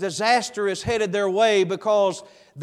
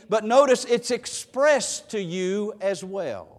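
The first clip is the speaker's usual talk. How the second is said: usual speaking voice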